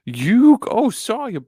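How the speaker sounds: put-on high, loud Brooklyn accent gone toddler-like; squeaky, overshot Brooklyn accent; Joey accent. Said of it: "I tried to do Joey's accent".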